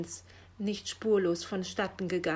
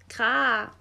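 short, louder raven caw; grumbled caw; 3 semitones higher, 7 dB louder than usual. A narrator's reading. Raven annoyed that the post is late, grumbled caw. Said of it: "brummte Meister Schwarzrock".

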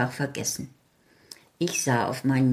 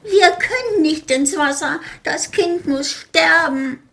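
much higher, louder panicked cry; shrill little-folk panic scream; terrified screaming; 12 semitones higher, 6 dB louder than usual. Acted. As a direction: wail in horror for the child. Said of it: "schrien entsetzlich".

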